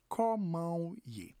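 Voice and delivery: "Comment ye?" said slowly, syllable by syllable; slow demo. Shown as a demonstration. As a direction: stretch out "Comment ye?" for learners. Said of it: "You can say comment ou ye".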